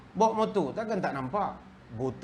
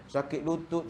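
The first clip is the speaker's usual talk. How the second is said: normal talking voice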